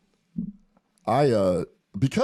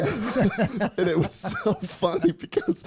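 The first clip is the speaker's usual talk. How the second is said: laughing; 11 semitones higher, slower than usual. Real, not acted. Laughing while retelling funny story.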